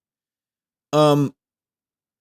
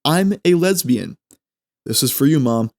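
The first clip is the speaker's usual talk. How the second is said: ordinary talk, nothing marked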